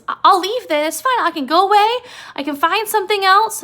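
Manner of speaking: high, loud protest; Lenny upset. Retold or imitated. Lenny threatening to leave George, high loud protest. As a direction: imitate a worked-up offer to go away.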